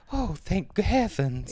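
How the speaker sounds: slow, put-on relieved lady voice; relieved old lady. The mother relieved at the driver's age, slow put-on voice. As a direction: sigh it out slowly like a prim old lady. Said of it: "you're scary good at that".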